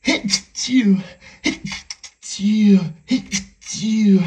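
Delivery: three loud achoos in a row; sneezing fit; sneeze. Acted. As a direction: sneeze hard, one after another. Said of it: "Oh no".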